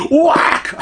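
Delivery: high, loud battle cry; mock ninja shout; triumphant shout. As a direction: shout it as you land the blow. Retelling hitting the bat.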